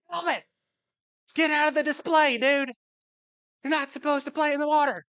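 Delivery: high loud mock scolding shout; yelling at kid. Dad shouting at his son in the koi pond, loud scolding. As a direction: bark at the kid to get out of the pond. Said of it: "I'm like".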